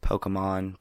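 "Pokemon" said POH-kuh-mahn; "Pokemon" with an unstressed "uh" in the middle; variant pronunciation. Shown as a demonstration. Showing how people pronounce "Pokemon" differently, middle vowel as "uh". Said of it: "Isn't it weird how everyone pronounces".

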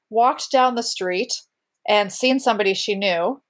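usual speaking voice

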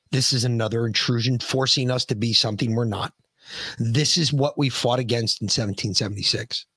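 dead serious. Real, not acted. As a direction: drop low and say it in real earnest. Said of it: "I'm being serious".

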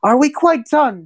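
high, haughty sneer; prim officer asking with disdain; stuffy Azorius mage. Acted. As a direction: look down on the brutes.

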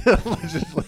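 high, loud laughing echo. Real, not acted. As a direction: crack up while saying it. Amused echo of co-host's word.

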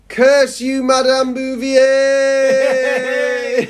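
high, loud villain-style cry, last word stretched out; melodramatic curse. Acted. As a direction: shake your fist at the heavens. Melodramatic cursing of the teacher.

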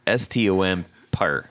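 S T O M per, spelled letter by letter. Shown as a demonstration. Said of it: "How's that spelled?".

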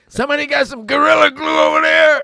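calling out. Pretend. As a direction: shout it high across the room. Calling out jokingly for glue.

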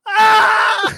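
high loud yelp; startled yelp. Retold or imitated. Host imitating the cop spilling coffee, a loud yelp. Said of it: "he goes".

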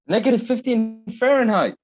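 high, loud cry of disbelief; shocked disbelief. Real, not acted. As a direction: repeat it back in real shock, pitch shooting up. Shocked echo of the temperature.

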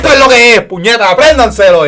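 high, loud fired-up command; passionate yell. Retold or imitated. Teacher shouting at students to learn it, high and loud. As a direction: bark it out with full passion.

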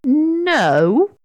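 high, sulky little refusal; wounded little sprite; hurt voice. A narrator's reading. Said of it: "in a hurt sort of voice".

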